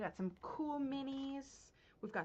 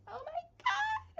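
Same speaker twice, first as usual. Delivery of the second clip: very high, loud drawn-out squeal; excited squeal; 6 dB louder, slower than usual. Real, not acted. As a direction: squeal it sky-high with delight. Excited aside about the pin.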